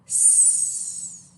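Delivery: long voiceless S with tongue hidden; drawn-out s like a snake. Shown as a demonstration. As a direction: hiss the S steadily like a snake. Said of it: "Keep it hidden".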